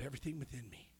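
normal talking voice

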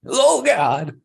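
high laughing exclamation; cracking up; laughing hard. Real, not acted.

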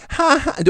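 high, squeaky mock laugh like a dog toy; squeaky fake laugh. Comedian mimics the heckler's squeaky laugh. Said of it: "man kan höra att hon har plasttuttar".

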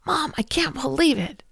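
mock upset daughter; imitating upset daughter. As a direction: whine it like a hurt child. Daughter upset after school.

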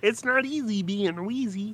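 high, thin wheezy puppet voice; frog voice. Pretend. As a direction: parody the frog song line, squeaky. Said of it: "that's my quote".

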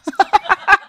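loud, very high-pitched cackle; high character laugh; cowboy's cackle. Acted. The Cosmic Cowboy laughs after speaking.

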